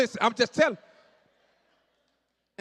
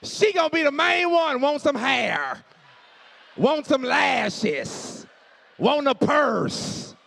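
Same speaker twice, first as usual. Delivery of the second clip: shouted rant; 6 semitones higher, 6 dB louder, slower than usual. Real, not acted. Ranting about wife's demands.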